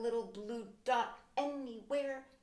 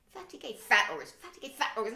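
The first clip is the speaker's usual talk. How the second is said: bickering back and forth; 8 dB louder than usual. Retold or imitated. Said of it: "I'm like".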